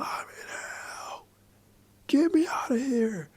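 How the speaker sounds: strained, hushed imitation of screaming; muted mock scream. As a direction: scream it under your breath, slowly. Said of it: "scream out the top of their lungs".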